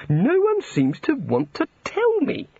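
high, slow, peevish complaint; irritable. A narrator's reading. God kept uninformed by the wizards, irritable. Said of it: "said the god irritably".

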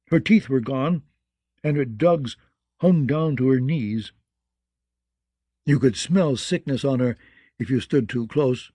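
usual speaking voice

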